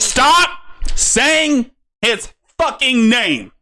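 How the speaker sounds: slow, furious high-pitched shout; angry yell. Real, not acted. Yelling at streamer over name.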